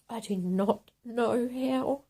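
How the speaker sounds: usual speaking voice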